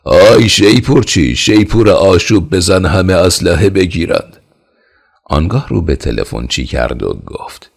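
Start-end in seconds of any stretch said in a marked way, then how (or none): 0.0-4.4 loud, high shout across the palace; king shouting to the bugler; shouting order. A narrator's reading. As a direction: call the bugler to sound alarm. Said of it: "داد زد".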